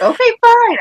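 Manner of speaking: high-pitched mock exasperation; retold giving in. Mom answering her daughter's demand for pants, exasperated retold surrender. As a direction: give in to a nagging kid, voice jumping up. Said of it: "I'm like".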